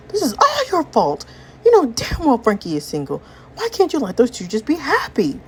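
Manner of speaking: high-pitched, exasperated blame; heated, high-voiced quarrel; angry accusation. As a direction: lash out at the one at fault. Acted quarrel blaming another character.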